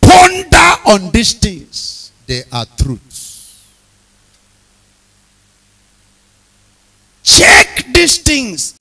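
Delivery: loud, slow shouted list, each item hammered; preaching at full force. Acted. Preacher warning congregation of road dangers, loud and slow. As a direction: call out every danger from the pulpit.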